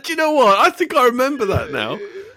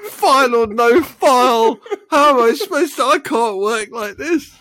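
loud, high-pitched comic meltdown; mock exasperation; 8 semitones higher, 3 dB louder than usual. Podcaster despairing over edit timings, mock exasperated. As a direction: protest as if driven to despair.

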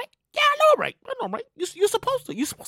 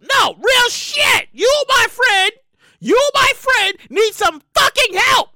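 high, loud, slow insistence; emphatic rant; 7 semitones higher, 3 dB louder, slower than usual. Real, not acted. Host scolding nosy people, loud and slow. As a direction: drive the point home hard. Ranting insult at stalkers.

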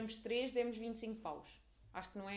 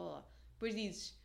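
ordinary talk, nothing marked

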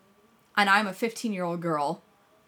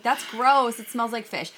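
playing it up; 5 semitones higher, 6 dB louder than usual. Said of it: "like, playing it up".

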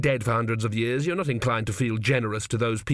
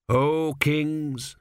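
higher, declaimed address; solemn appeal; 4 semitones higher than usual. A narrator's reading. Kneeling priest to the dead kings, solemn appeal. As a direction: call upon them formally.

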